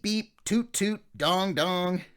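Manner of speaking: high, loud mock toots of instruments tuning; band tuning up. Narrator imitating the band tuning up, high and loud. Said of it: "when you heard beep".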